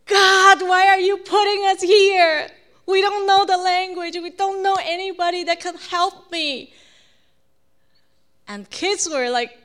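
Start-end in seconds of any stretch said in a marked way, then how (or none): 0.0-6.7 loud, high-pitched retold rant at God; furious outburst. Husband blames God after bike shop refusal, furious outburst. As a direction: cry out to God in acted exasperated fury. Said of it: "he was so mad".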